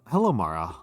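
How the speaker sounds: usual speaking voice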